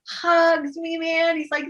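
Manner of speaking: usual speaking voice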